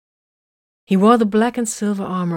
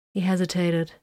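plain reading voice

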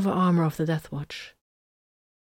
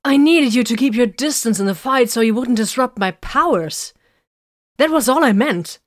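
urgent explanation; 6 semitones higher, 7 dB louder than usual. A narrator's reading. Vox explains, agitated.

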